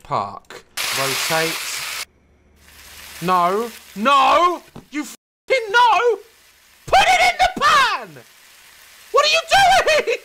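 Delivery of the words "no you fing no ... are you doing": much higher, louder angry shout; frustrated yell. Real, not acted. Gamer yelling at the steak to go in the pan, furious shout. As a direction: scream it in frustration.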